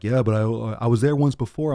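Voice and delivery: mock indignant comeback; protesting mock quote. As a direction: push back loudly, insisting, as someone else. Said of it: "they're like".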